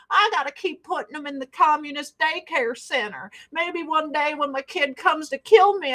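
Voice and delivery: high, exaggerated excuse-making voice; hammed-up parody of a parent; mocking helpless parents. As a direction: pitch it up and climb as it goes. Mocks parents making excuses for daycare.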